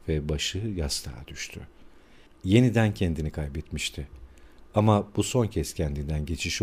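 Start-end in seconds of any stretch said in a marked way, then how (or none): none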